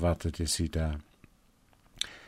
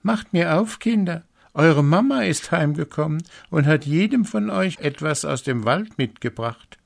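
high sweet false mother voice, much higher; wolf posing as mother; 10 semitones higher, 5 dB louder than usual. A narrator's reading. Wolf at the door a third time, faking mother sweetly. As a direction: coax them like a sweet mother. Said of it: "klopfte an und sagte".

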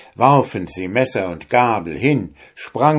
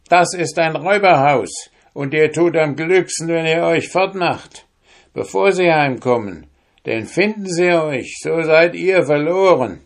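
put-on old woman warning; 9 semitones higher, 4 dB louder than usual. Old woman warns the soldiers.

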